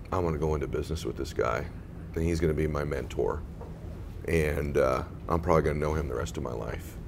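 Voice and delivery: recalling the moment he knew this man would be his mentor, genuinely moved; emotional. Real, not acted. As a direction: hold back real emotion, quietly. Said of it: "I'm gonna get emotional again".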